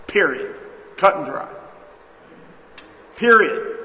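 loud, final "Period." hammered down; emphatic declaration. Real, not acted. Preacher to church, declaring it final: loud. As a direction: slam the word down like a gavel.

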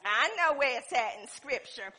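loud, high-pitched pulpit delivery; full-force preaching. Real, not acted. Preacher telling people their lifestyle must match belief, forceful preaching.

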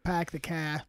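badly attempted Boston "car"; Boston accent attempt. Shown as a demonstration. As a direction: say "pack the car" slowly, flat A and dropped R. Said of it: "You gotta get the I's".

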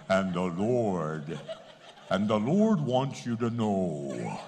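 deep, slow mock preacher; pulpit voice. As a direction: put on a ponderous, low pulpit voice. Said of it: "talk like this".

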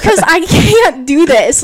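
mock crying protest. Crying beginner explains to brother, mock wailing. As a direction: drag out a retold tearful complaint. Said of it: "Why are you crying?".

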